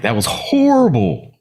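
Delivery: slow, high, despairing whine; self-pitying wail. Retold or imitated. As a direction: wail it like all is lost. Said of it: "I've been like".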